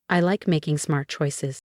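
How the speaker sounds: ordinary delivery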